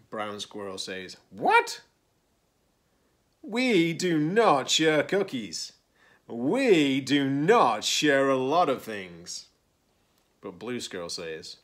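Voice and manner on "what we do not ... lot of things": high, loud, slow refusal; indignant. A narrator's reading. Brown Squirrel rejects sharing one cookie, indignantly. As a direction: refuse firmly, leaning on each word.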